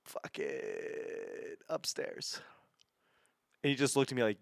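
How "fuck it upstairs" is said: much lower, soft, half-whispered resignation; muttered aside. Retold or imitated. Starstruck kid giving up on directions, soft mumble. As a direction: give up and mumble it under your breath. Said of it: "I just was like".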